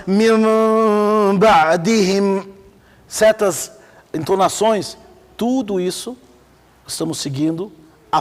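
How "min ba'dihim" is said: slow chant with a long held nasal hum; sustained, level-toned recitation; Quranic recitation. Shown as a demonstration. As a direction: draw out the "n" of "Min" in recitation. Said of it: "Fala assim".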